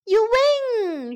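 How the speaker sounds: high, loud cheer; excited shout. Acted. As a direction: shout it out happily. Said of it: "shouted Danny".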